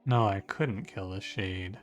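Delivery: normal talking voice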